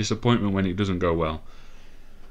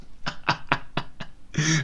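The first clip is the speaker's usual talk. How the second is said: giddy relieved laughter; 8 semitones higher, 3 dB quieter than usual. Real, not acted. Laughing after the goal.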